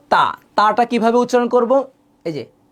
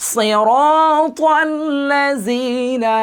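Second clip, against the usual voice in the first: higher, slow melodic chant; Quran recitation; 6 semitones higher, much slower than usual. Shown as a demonstration. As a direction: draw out সিরাতাল্লাযীনা slowly. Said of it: "recitation demo".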